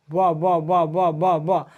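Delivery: ब repeated alone in an even string from the lips; lip sound demo. Shown as a demonstration. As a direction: sound out ब on the lips, one after another. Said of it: "ब बोल रहा तो कोची ओठ".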